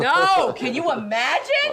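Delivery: laughing through the words; giggling disbelief; laughing, can you imagine. Real, not acted. Laughing at the joke.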